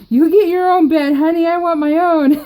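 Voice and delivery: playful demand, cracking up; teasing husband through laughter; laughing mock demand. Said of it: "And I was like".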